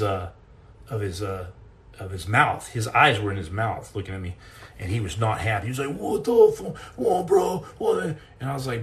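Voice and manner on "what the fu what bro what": high-pitched mock outrage; indignant fox. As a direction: imitate a stunned fox protesting in a high voice. Fox's outraged reaction, acted.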